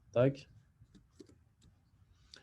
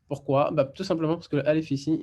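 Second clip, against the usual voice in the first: ordinary talk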